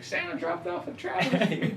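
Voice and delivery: mock-amazed squeal through a laugh; imitated excitement. Quoting someone excited about Santa's gift.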